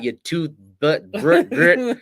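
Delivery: slow, loud, rising mock taunt breaking into a laugh; Bird's trash talk. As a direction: ham up a crude trash-talk line until you crack up. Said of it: "He'll just be like".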